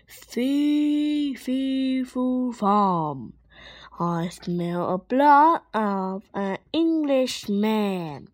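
slow booming giant chant; giant's roar. Acted. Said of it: "The giant sniffed the air and roared".

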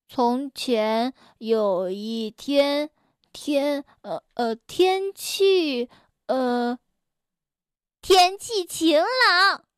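慢慢地、一顿一顿地念; 刚学认字磕磕绊绊地读; 结巴念书. Acted. 结结巴巴地念书. (初学读书结结巴巴地慢念)

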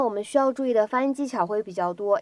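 ordinary delivery

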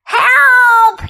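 high, loud cry for help; frightened yell. Acted. Kipper yelling from his bed, frightened yell. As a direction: yell out in alarm. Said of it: "yelled Kipper".

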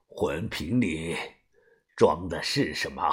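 低沉追问. Acted. 把头低沉追问.

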